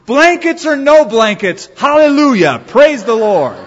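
high, loud, exultant cry; excited outburst. Retold or imitated. Betty standing up mid-sermon, acted excited outburst. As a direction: burst out as if unable to hold it in. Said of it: "she got more and more excited".

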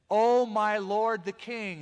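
loud, high, slow call to the king. Acted. David calls out to Saul from afar, loud and slow. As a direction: call out after the king from a distance.

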